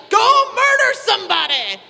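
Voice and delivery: loud, high, slow demonic command; devil voice. Retold or imitated. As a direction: boom it out like a cartoon devil. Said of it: "he was like".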